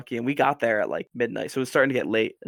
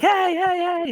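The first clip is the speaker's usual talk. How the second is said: mock mom shout, much higher and louder; mom calling out; 5 dB louder, slower than usual. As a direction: call out like a startled mother. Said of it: "and was like".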